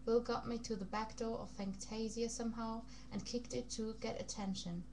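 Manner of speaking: ordinary delivery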